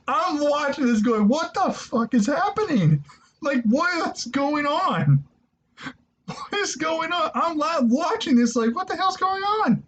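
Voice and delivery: high-pitched, bewildered retelling of a live reaction; incredulous laughing disbelief. Retold or imitated. Viewer retelling his shock at the match, high incredulous voice. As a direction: reenact total disbelief, voice pushed way up high.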